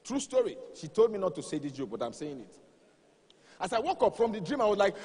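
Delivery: ordinary talk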